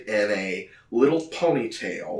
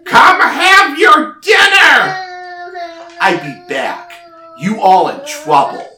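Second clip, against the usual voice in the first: giant calling to dinner; 9 dB louder than usual. Acted. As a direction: shout it across a giant house. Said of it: "another giant voice".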